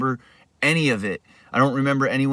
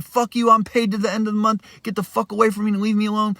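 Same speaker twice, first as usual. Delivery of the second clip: higher, fast angry yell; 8 semitones higher, faster than usual. Retold or imitated. Refusing to leave, paid to month end, angry yell. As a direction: shout back, rushing. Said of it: "I'm yelling at him".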